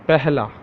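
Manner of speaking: Pehla as "peh-la" with a breathy h; Urdu word. Shown as a demonstration. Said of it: "how to say in Urdu?".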